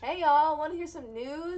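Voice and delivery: big booming hello to the audience; loud show-host hello to the viewers; loud host greeting. Acted.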